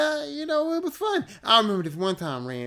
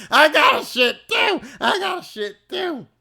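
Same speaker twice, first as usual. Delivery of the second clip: loud high shouting; shouted plea; 11 semitones higher, 10 dB louder than usual. Retold or imitated. Scarborough shouting at Ramin for the toilet, loud high shout. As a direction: yell it desperately twice. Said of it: "he just said".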